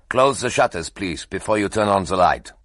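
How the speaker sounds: a German in the dark giving a polite order; German accent. Acted. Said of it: "A voice with a strong German accent said".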